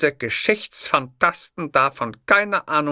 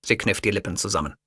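ordinary delivery

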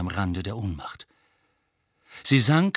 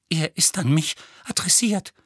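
trembling voice; 6 semitones higher than usual. Acted. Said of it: "mit zitternder Stimme".

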